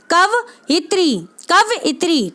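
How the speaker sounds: "कव इत्री" slowly split as kav-itri; teacher's slow two-part "कव इत्री"; careful model pronunciation. Shown as a demonstration. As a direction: break "कव इत्री" after kav, no i on the व. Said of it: "ऐसे पढ़ेंगे इसको".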